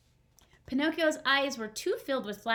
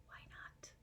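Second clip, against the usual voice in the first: put-on whispered prompt to the kids; 26 dB quieter than usual. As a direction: whisper the question.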